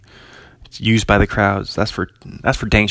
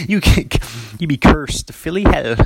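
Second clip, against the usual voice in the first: laughing; 4 semitones higher than usual. Real, not acted. Laughing joke about Philly hell.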